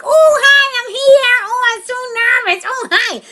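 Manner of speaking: very high, loud squealing character; Pat. Retold or imitated. Actor called in to audition, squealing character. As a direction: squeak nervous hellos at full volume. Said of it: "If I'm gonna be Pat".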